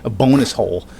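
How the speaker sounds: ordinary talk, nothing marked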